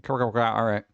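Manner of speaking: ordinary talk, nothing marked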